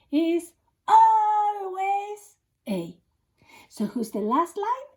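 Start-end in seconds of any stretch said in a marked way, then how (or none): 0.9-2.4 "always" held long, high and loud; emphatic always. Shown as a demonstration. Teacher reminding pupils about the last line, long loud "always". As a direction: stretch out "always" like a chant. Teacher stresses the mnemonic word.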